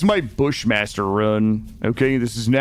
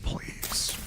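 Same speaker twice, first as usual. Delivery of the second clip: whispered plea; 13 dB quieter than usual. Real, not acted. Begging chat after pleading.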